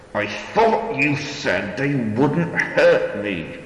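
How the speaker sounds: slow, loud words through clenched teeth. A narrator's reading. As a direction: force it out through gritted teeth. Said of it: "through clenched teeth".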